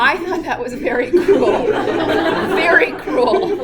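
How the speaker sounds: loud laughing protest; loud and slowed, laughing. Real, not acted. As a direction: laugh while stressing very cruel. Laughing emphatic.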